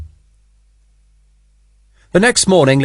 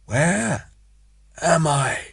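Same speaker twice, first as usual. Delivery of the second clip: soft, barely-there question; a wounded man coming round, faint; weak, dazed; 4 semitones higher, 7 dB quieter, much slower than usual. A narrator's reading. Wounded Sharp waking in the death room, faint and dazed. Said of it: "crying now".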